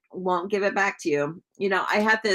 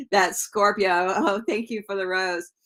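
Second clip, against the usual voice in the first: usual speaking voice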